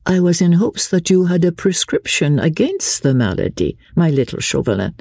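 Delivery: high, coaxing society lady; lively lady, playful. Acted. Marguerite asks her friend for a cure, high and playful.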